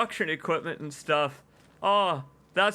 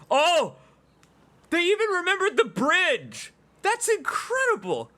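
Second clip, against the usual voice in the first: thrilled exclamation; 11 semitones higher than usual. Real, not acted. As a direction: shout it out in wonder. Spots remembered bridge.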